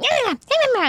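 tiny high yapping creature; squeaky little gremlin yaps; yappy elf. Retold or imitated. Elves yapping around ankles.

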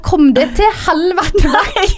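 high, drawn-out mock yell; retold scream. Chasing night visitors from the backyard, retold as a high mock scream. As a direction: act out a furious order without full volume. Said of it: "Og jeg skriker".

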